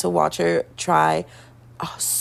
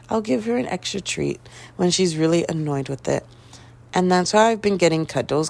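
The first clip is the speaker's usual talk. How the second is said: ordinary delivery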